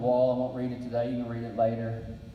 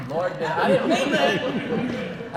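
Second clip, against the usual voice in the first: higher, louder, slow, drawn-out plea; a hammed-up cry of I didn't mean that; mock protest to God; 7 semitones higher, 7 dB louder, much slower than usual. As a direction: loudly take the wish back from the Lord, slowly. Joking prayer after the fire.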